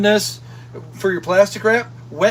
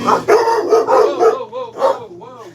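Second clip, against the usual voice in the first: high startled whoa; whoa, whoa as the dog rushes in; much slower than usual. Real, not acted. Startled by the dog, high whoas.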